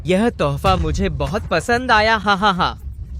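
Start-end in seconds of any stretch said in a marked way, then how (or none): 2.2-3.2 slow villain laugh. Acted.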